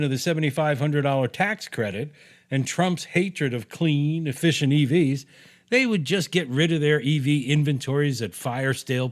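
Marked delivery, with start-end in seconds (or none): none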